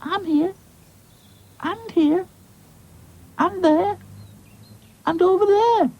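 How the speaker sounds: very high, calling doll voice; Lucy, scattered doll. Acted. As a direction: call out piece by piece, in short bursts. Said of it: "called Lucy".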